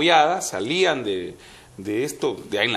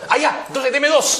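excited shout through laughter; eager customer; 10 semitones higher than usual. Acted. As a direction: blurt it out, delighted with himself. Customer's eager punchline reply.

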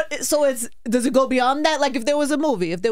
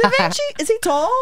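surprised; 9 semitones higher than usual. Real, not acted. Second take, high and surprised.

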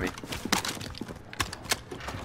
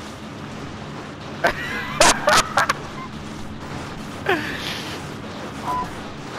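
high silent wheeze of laughter; breathless cracking-up laugh; wheezing laugh; 4 dB quieter than usual. Real, not acted. Laughing at teammate.

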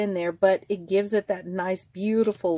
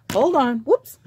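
eager, louder and higher burst; excited; 4 semitones higher, 6 dB louder than usual. Real, not acted. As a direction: rush in, giddy and fumbling. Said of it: "Look, I'm getting excited".

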